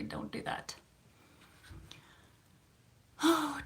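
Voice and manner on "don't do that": hushed note to self, almost breathless; self-scolding whisper. Retold or imitated. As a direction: whisper a little warning to yourself. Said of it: "gotta check myself and say".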